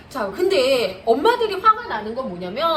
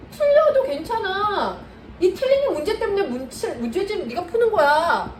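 slower, drawn-out "틀려도 괜찮아" to soothe; slow reassurance; 7 semitones higher than usual. Shown as a demonstration. Tutor modelling the comforting line for mothers, slowly and tenderly. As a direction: stretch each word out, calm and kind. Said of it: "계속 얘기하셔야 돼요".

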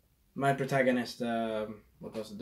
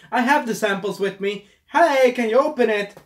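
fake friendly lie; 7 semitones higher, 5 dB louder than usual. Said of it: "I would say".

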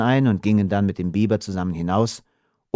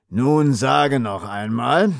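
slow, drawn-out demand; 3 semitones higher, slower than usual. Acted. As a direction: press for an answer, word by word. Elder smuggler's demand to captive.

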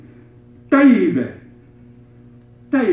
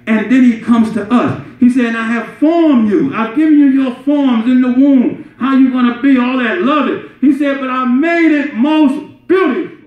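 raised, emphatic sermon voice; higher-pitched, fervent preacher; impassioned preaching; 7 semitones higher, 4 dB louder than usual. Real, not acted. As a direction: proclaim it to the congregation.

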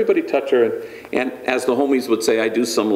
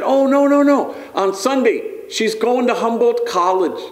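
proud father gushing, pitched way up; excited denial; 12 semitones higher than usual. Retold or imitated. Bandit reassuring the priest, high excited voice.

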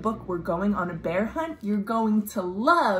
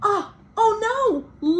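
mock alarm; 12 semitones higher, 4 dB louder than usual. Lambs block the path.

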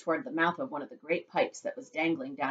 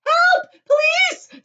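very high, loud shriek for help; screaming; 13 dB louder, much slower than usual. A narrator's reading. Mother calls police for sucked-up son, screaming. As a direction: scream out in panic. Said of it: "screamed Missus Gloop".

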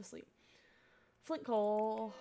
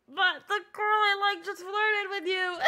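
slow, hammed-up tearful complaint; mock whining; 5 semitones higher than usual. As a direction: wail it like a jilted boy. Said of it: "He'd be like".

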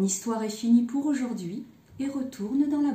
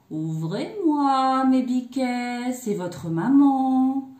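slow, drawn-out, loud singsong call; wolf posing as mother; 3 semitones higher, 6 dB louder, slower than usual. A narrator's reading. Wolf at the kids' door posing as mother, slow drawn-out call. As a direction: stretch each phrase like a wolf faking a mother. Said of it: "Toi, tu as la voix toute grave".

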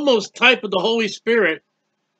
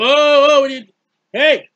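outraged people; 5 semitones higher, 5 dB louder than usual. Retold or imitated. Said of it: "and people".

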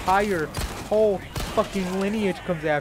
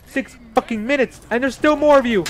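higher and louder, ranting at the enemies; exasperated shouting; 8 semitones higher, 9 dB louder than usual. Real, not acted. Gamer rants at enemies after long fight, loud shouting. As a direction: vent the frustration at full volume.

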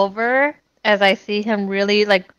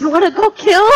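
eager; 11 semitones higher, 3 dB louder than usual. Acted. Eager character asks to fight.

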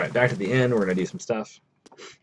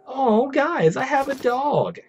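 much higher, louder delighted cry; excited squeal; 7 dB louder, much slower than usual. Real, not acted. Streamer tells chat he got a dog, squealing. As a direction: burst with joy at the new dog.

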